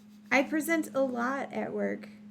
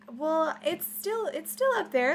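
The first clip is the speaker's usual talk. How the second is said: firm projecting mom voice; 6 semitones higher, 5 dB louder than usual. Real, not acted. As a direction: speak up like a mom addressing a room. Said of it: "I'll try and use my mom voice".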